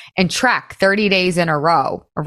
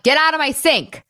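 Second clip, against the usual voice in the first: mock yell; 8 semitones higher than usual. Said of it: "yelling at my friends last night".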